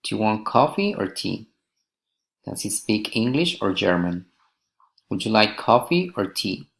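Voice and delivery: up on "coffee" and "English", down on "tea" and "German"; rising then falling intonation. Shown as a demonstration. Teacher models alternative questions, "coffee" rising, "tea" falling. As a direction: lift the first choice, "coffee", drop the last, "tea". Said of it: "rising and falling intonation".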